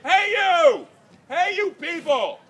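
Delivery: hey, hollered high and loud across a crowd; yelling at a crowd. Shown as a demonstration. Showing how he yells at a party crowd, loud high holler. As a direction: call out big to grab strangers. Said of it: "you're yelling at a crowd like".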